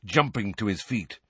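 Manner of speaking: plain reading voice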